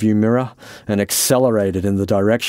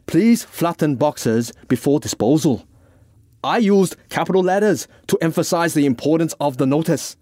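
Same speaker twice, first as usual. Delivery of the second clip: higher, insistent character voice; exasperated Francis; 8 semitones higher than usual. Acted. Francis points the Irishman to his notice, exasperated. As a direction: press each point, exasperated.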